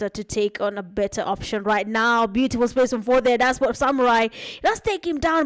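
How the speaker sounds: energetic live esports caster; play-by-play commentary. Acted. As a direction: call the match as it happens.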